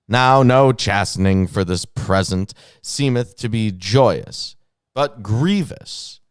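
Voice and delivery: loud, emphatic King James declamation; dramatic scripture reading. Acted. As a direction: declaim the verses with stretched key words.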